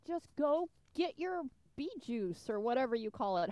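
usual speaking voice

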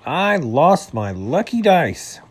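mildly distraught wail; upset. A narrator's reading. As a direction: stretch it out as a woeful complaint. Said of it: "an upset skunk named Melvin".

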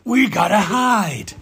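high, hurried pretend panic; hammed-up fright for the toddler; playful mock alarm. Pretend hiding from dinosaur game.

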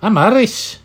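high, put-on Irish accent. Shown as a demonstration. Interviewer shows the guest how, Irish accent. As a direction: echo it in a lilting Irish accent. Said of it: "I can do an Irish accent".

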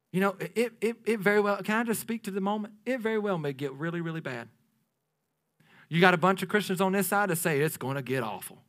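ordinary delivery

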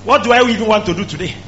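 high, loud, shouted preaching; fiery preacher shouting; full-force preaching. Acted. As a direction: drive it home at the top of your voice.

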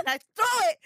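high, loud burst as she throws; telling the moment the bottle flew; excited retelling. Real, not acted. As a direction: spike the voice up like the throw itself.

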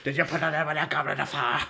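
mock-frantic Dumbledore, words tumbling together; agitated Dumbledore. Acting out the meme for a co-host, hammed-up agitation. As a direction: blurt it out as a hammed-up, agitated wizard. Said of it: "Dumbledore asked calmly".

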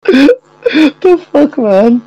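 high laughing shriek. Real, not acted. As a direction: squeal with laughter. Reacting to cowboy bit.

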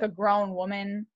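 normal talking voice

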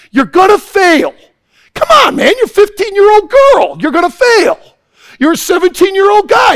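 loud, high-pitched warning shouts; preaching at full force. Acted. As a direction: hammer the warning home to the teens.

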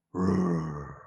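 growled bear roar. Shown as a demonstration. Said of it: "that was my bear impression".

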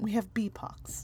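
hushed dramatic whisper. Acted. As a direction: whisper it like a dire secret.

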